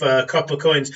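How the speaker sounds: normal talking voice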